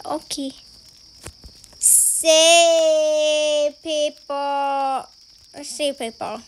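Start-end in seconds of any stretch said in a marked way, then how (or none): 1.8-5.1 very slow, stretched-out words; drawn-out call. Pretend. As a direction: stretch each word out long.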